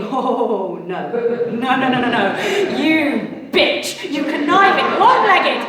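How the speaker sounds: building to a high loud outraged shriek; furious inner rant. Retold or imitated. Watching the models cut the queue, inner voice climbs to a high outraged shriek. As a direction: let the panic climb into fury. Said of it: "I'm thinking".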